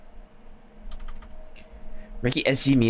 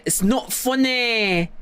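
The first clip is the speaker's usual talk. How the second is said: higher and slower mock protest; mock indignant, high; 8 semitones higher, slower than usual. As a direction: whine it, mock upset.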